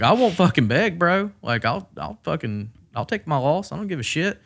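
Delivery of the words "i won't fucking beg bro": defiant. Real, not acted. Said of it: "insisting he won't beg".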